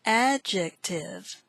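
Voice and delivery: "adjective" slowly parted as ad-jec-tive; syllable by syllable. Shown as a demonstration. Teacher introduces word five, "adjective" in slow syllables. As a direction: sound out "adjective" one syllable at a time, slowly.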